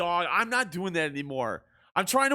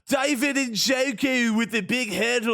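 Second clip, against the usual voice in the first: put-on a mangled British commentator accent, part Scottish; botched British broadcaster; 4 semitones higher, slower than usual. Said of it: "That sounds like Scottish, British, and someone on drugs".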